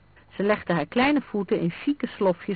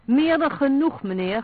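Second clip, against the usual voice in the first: louder, higher, indignant outburst; 8 semitones higher, 7 dB louder than usual. A narrator's reading. Housekeeper protests to Vlaanderen, loud and indignant. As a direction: protest hotly like an outraged housekeeper. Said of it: "verklaarde de huishoudster verontwaardigd".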